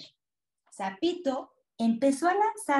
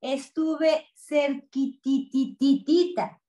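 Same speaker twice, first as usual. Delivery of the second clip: CERQUITITITITITA stretched in tiny repeated syllables; slow, playful emphasis on the endless "ti-ti-ti" in cerquititititita; stuttered diminutive; slower than usual. A narrator's reading. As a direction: draw out "cerquititititita" slowly, tapping each "ti". Grandpa relieved after narrow escape.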